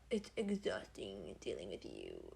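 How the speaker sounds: low, slow, soft drone; exhausted, sluggish put-on voice; impression. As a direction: drag it out low and weary. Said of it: "we're both doing impressions".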